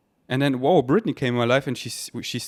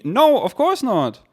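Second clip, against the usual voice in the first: much higher, louder and slow denial; emphatic no; 8 semitones higher, 6 dB louder, slower than usual. Real, not acted. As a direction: shut the idea down firmly. Emphatic answer to own question.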